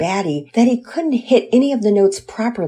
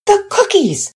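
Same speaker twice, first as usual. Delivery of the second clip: high startled shriek about the cookies; alarmed cry; 5 dB louder than usual. Acted. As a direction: gasp it out in sudden alarm. Burning cookies discovered.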